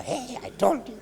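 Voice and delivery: high, squeaky crowing over the leopard; gloating donkey. Retold or imitated. The donkey jumps about gloating at the leopard in a high voice. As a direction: crow it out like you just won.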